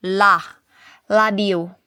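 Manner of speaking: "La" alone, then "Ladio", both on a clear L. Shown as a demonstration. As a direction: model "La", then "Ladio", with L replacing R. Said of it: "Di sila ka-pronounce og R". (litok nga "La", dayon "Ladio", L imbes R)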